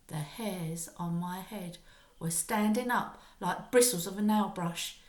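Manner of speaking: ordinary delivery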